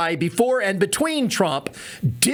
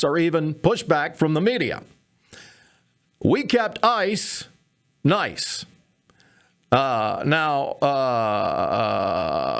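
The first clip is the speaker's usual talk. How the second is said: measured presidential voice with halting pauses; Barack Obama impression. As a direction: play a former president, drawling out each "uh". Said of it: "Barack Obama is here to explain".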